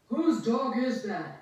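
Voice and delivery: a security guard barking a question; exclaiming guard. Acted. Guard chasing the dog demands an answer, stern exclaim. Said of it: "exclaimed one security guard".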